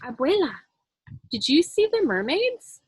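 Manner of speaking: put-on a boy excitedly asking his grandma; excited child. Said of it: "Julian says".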